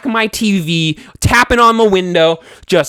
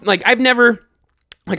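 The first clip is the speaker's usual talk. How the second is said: ordinary talk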